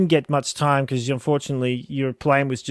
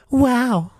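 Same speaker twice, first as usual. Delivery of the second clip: put-on high cute anime girl; 9 semitones higher, 4 dB louder than usual. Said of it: "he says it really like".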